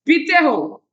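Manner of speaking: पीते punched hard, falling after; stress on पीते. Shown as a demonstration. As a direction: lean on पीते to insist. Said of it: "जोर देते हुए कैसे बोलूंगा". (पीते पर ज़ोर देकर)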